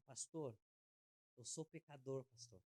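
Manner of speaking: softly voiced, trailing away at the end; subdued admission to a pastor; quiet confession.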